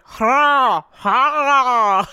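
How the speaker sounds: drawn-out throaty dog noise attempt; imitated dog growl. Showing how Lola goes at Benny, imitated growl. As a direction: growl it like a play-fighting dog. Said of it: "Is it a growl?".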